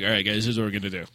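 low, fast military briefing; gruff action-hero command; G I Joe voice. Retold or imitated. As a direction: drop low and rattle it off like a squad leader. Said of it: "total G I Joe voice".

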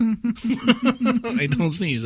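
slow high laughing protest. Real, not acted. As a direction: laugh through the denial. Laughing insistence.

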